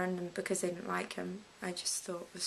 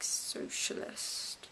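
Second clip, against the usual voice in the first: slow, hushed whisper; drawn-out stage whisper; whispered confession; 5 dB quieter, much slower than usual. Real, not acted. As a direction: whisper the word slowly, drawing it out. Confiding her politics in a stage whisper.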